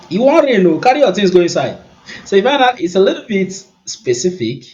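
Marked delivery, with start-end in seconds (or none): 0.1-1.8 loud, high Nigerian Pidgin warning. Shown as a demonstration. Showing viewers the Pidgin warning, high and loud in Nigerian Pidgin. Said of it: "if I want to speak in Pidgin".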